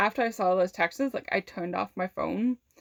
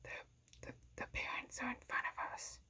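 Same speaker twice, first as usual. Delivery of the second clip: whisper; 19 dB quieter, faster than usual. Retold or imitated. Came up, like, really, like, close.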